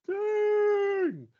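high, loud hype yell of the name; excited shout. Pretend. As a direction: belt it like a crowd pop. Echoing the hype reveal.

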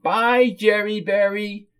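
high, slow whiny goodbye; whiny hostess farewell. Acted. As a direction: draw out the goodbye in a high whine.

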